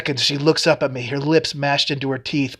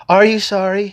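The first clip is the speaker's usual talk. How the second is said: sharp, higher angry question; 6 semitones higher, 5 dB louder than usual. A narrator's reading. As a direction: turn on him in anger. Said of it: "She turns on me angrily".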